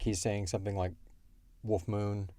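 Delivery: ordinary talk, nothing marked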